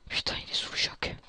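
hushed remark to oneself; whispered aside. Acted. Escapee remarking on a shocked prisoner, whispered aside. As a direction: drop to a whisper.

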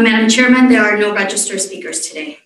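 usual speaking voice

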